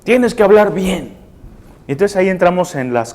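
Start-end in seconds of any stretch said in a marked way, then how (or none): 0.0-1.2 raised-pitch stern correction; mock scolding. Adult ordering a child to speak properly, mock stern. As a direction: lay down the rule sternly.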